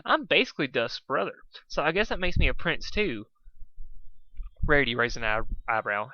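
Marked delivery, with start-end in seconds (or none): 0.0-3.3 smooth. Acted. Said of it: "Spike said smoothly".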